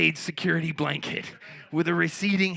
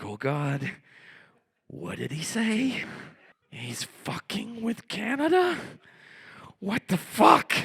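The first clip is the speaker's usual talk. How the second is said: shocked reaction; 7 semitones higher than usual. Retold or imitated. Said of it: "I pull out the phone and I'm like".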